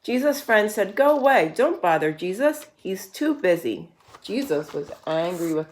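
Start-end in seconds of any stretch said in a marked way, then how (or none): none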